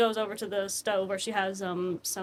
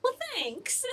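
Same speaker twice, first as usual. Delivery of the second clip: high, a touch flustered; lightly voiced shy thanks; flattered hostess; 9 semitones higher, 4 dB quieter than usual. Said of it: "And she's like".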